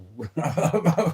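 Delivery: a sudden high laugh at herself; high burst of laughter. Real, not acted. A woman laughing at her own accent slip, high laugh. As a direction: laugh out, caught slipping into Scouse.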